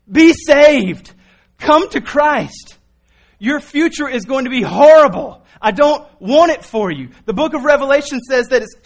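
loud, high, urgent pleading sermon; fiery preaching. Real, not acted. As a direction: hammer it home like a revival preacher. Preaching at full force.

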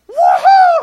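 a huge high joyful whoop, full volume; ecstatic woohoo. Retold or imitated. Pastor hears his old roommate is saved, screams with joy. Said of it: "I'm, like, screaming".

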